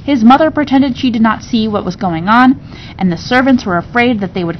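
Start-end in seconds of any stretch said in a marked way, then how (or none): none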